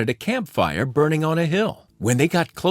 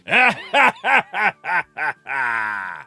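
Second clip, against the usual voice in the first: cowboy cackle. Acted. Phantom cowboy cackling at the fleeing gang. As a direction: cackle wildly as the phantom rides off. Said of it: "cackled the cowboy".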